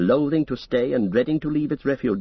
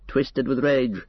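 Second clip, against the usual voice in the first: plain reading voice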